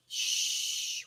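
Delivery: whispered shushing; hushing shh. Real, not acted. Streamer shushing chat about streaming, whispered. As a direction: hush them with a quick shh.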